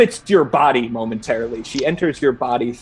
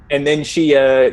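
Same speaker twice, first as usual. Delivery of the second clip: usual speaking voice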